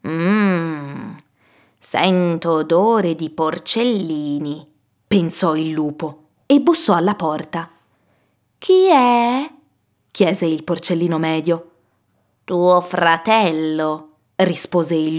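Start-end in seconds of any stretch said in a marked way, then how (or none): 0.1-4.7 slow, lingering wolf voice; prowling wolf on a long hum; hungry wolf. Acted. Hungry wolf sniffs out pigs. 8.6-9.5 high, drawn-out piglet call; high-pitched questioning young pig; middle pig. Acted. Middle pig answers the door. 12.5-14.1 slow, drawn-out wolf voice; wolf posing as a brother, lingering; sly wolf. Acted.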